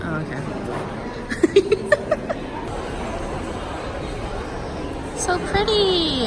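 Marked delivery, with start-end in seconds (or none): 0.5-5.1 long high whispery giggle; airy high-pitched giggling. Real, not acted. Laughs at decorations. 5.2-6.3 high, slow gushing delight; drawn-out high-pitched gush. Real, not acted. Gushing at decorations.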